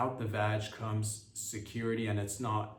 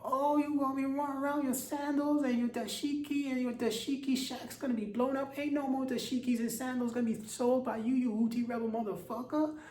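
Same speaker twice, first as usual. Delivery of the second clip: pitched-up hammed-up bravado; J D Vance; 13 semitones higher than usual. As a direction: squeal it out like a cartoon warmonger. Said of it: "And J D's go".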